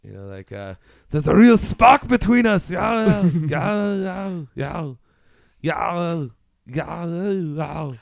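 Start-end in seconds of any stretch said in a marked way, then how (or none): 1.1-2.7 loud, high, slow Austrian action-star voice; Arnold Schwarzenegger. Acted. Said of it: "if Arnold Schwarzenegger played Electro". 2.7-7.9 mock action-hero yowls, fading; Arnold Schwarzenegger. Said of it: "that's how he does his".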